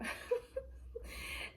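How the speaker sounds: high breathy laugh. Real, not acted. Laughing at her own story of crying in the rain, laughing. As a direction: laugh helplessly, high and airy.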